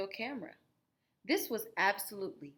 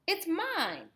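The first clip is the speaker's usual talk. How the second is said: put-on insistent child; 10 semitones higher, 4 dB louder than usual. Children quarrel over camera.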